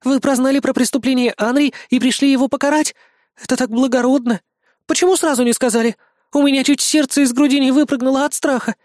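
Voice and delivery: high, gushing woman's voice; admiring and excited. A narrator's reading. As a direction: gush with relief and admiration. Admiring woman gushes to rescuer.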